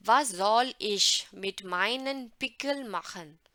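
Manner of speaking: deliberate classroom reading, word by word; slow clear reading. Shown as a demonstration. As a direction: spell each word out slowly for learners. Teacher reading German line loudly.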